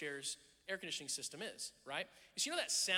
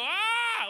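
loud high panicked "Ah!" with hands up; alarmed yell; 14 dB louder than usual. Shown as a demonstration. Pastor shows congregation the wrong response: a loud high yell. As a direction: scream it out like you're alarmed. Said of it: "put your hands in the air and go".

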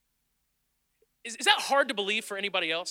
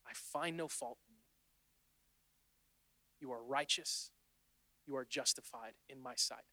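hushed, slow and tender, much softer; gentle voice of God; 8 semitones lower, 16 dB quieter, slower than usual. Acted. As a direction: speak as God reassuring quietly. God speaking gently to the believer.

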